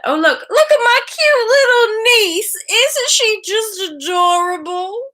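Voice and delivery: adoring aunt squeal; doting gushing. Real, not acted. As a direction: gush in a squeaky drawn-out coo. Gushing over niece's photo.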